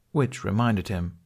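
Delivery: plain reading voice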